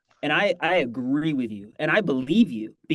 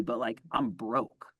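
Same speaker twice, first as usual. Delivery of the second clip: quiet admission; 4 semitones lower, 8 dB quieter, slower than usual. Retold or imitated. Voicing broke agents.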